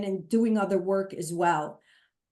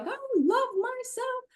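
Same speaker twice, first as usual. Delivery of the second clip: much higher, sing-song affirmation; mock the instant self-love leap; a lilting, over-bright pretend affirmation; mock cheery. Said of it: "we just wanna leap and just be there like".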